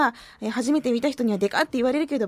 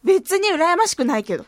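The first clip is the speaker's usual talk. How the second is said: higher, louder protest of indifference; mock-defensive denial; 6 semitones higher, 5 dB louder than usual. Host on her married listeners, feigning indifference. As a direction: insist a bit too hard.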